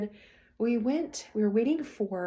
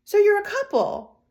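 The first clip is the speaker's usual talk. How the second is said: mock older saleslady; 12 semitones higher, 8 dB louder than usual. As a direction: play the nosy older saleslady. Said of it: "And she goes".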